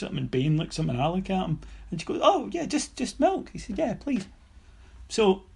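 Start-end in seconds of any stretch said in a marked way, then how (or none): 2.2-3.5 high, startled secretary voice; a lightly mocked secretary, caught off guard; imitating the secretary. Secretary stumped by milk request. 3.8-4.3 mock polite reply. Mark answering the secretary.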